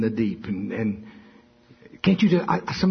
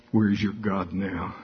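lower, cold taunt; devil taunting; 5 semitones lower than usual. Retold or imitated. Devil mocking the suffering apostle in a low voice. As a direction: let the devil sneer it low. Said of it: "the devil be saying in your ear".

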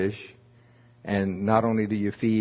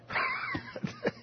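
high squealing laugh; 11 dB quieter than usual. Real, not acted. Laughing at own impression.

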